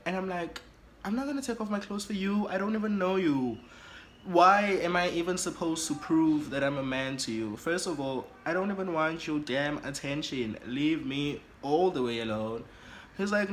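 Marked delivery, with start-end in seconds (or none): 1.0-12.6 high, indignant outburst; indignant refusal. Acted. Telling the stranger to leave me alone, indignant refusal. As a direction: pitch it up and argue back, affronted. Acted quarrel with the suitor.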